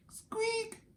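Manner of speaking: tiny squeak, very high; mouse squeak. Acted.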